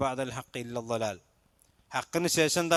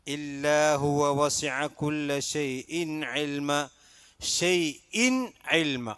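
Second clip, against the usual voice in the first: slow, drawn-out chanted recitation; Quran recitation; much slower than usual. Shown as a demonstration. Teacher reciting a verse for students, slow chant. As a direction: intone the verse slowly as a model. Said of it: "teacher recites verse as model".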